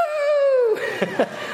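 high delighted burst of laughter; joyful laugh. Retold or imitated. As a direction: laugh out the joy. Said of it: "I was so excited".

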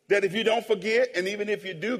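ordinary talk

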